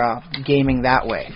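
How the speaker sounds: usual speaking voice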